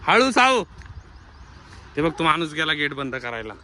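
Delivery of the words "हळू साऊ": high, loud slow-down call; urgent shout to be careful; calling out a warning. Real, not acted. Warning child while running.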